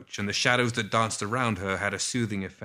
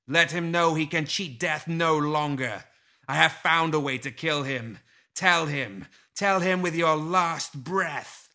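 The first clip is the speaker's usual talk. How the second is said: booming voice; 6 semitones higher, 3 dB louder than usual. A narrator's reading. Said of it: "voice came as booming thunder".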